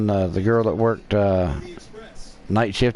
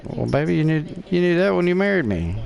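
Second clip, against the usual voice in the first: retold higher-pitched reply to wife; lightly protest, pitched up; teasing comeback; 7 semitones higher than usual.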